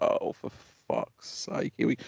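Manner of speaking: mild mock mutter, slow and unvoiced; muttered curse under breath. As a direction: curse quietly to yourself at the blue lights. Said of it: "I was like".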